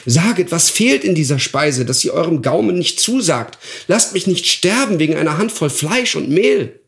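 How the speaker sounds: clinging to the guest's feet; begging dwarf. Acted. Dwarf on his knees begs the guest, desperate.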